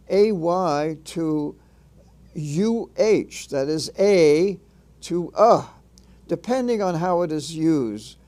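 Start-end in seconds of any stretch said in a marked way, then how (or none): 4.0-4.6 A said as a stressed "ay"; long A. Shown as a demonstration. Teacher contrasting two pronunciations, A said clearly as "ay". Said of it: "Its pronunciation varies from". 5.4-5.7 uh said as a bare schwa; short A. Shown as a demonstration. Teacher contrasting two pronunciations, uh as the short sound. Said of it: "Its pronunciation varies from".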